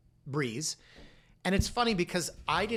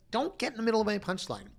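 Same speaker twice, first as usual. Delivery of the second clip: high mock scolding; 8 semitones higher than usual.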